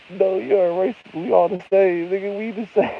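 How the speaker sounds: high, loud, pleading dog voice; the dog yells up at the wolf, high and insistent; dog's voice. Retold or imitated. Dog insisting to the wolf they're the same, high and loud. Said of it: "The dog is like".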